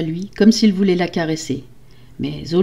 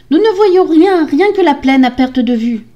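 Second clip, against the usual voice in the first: high, loud distant reply; calling back; 9 semitones higher, 8 dB louder than usual. Acted. Sisters answering the wife, high anxious shout. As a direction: call the news down from a rampart.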